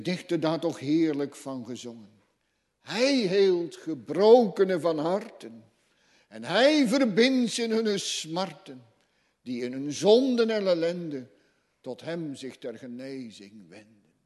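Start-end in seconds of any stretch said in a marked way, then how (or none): 2.8-11.3 higher, louder declaiming; fervent psalm recital. Acted. Preacher declaiming the psalm to the congregation, loudly.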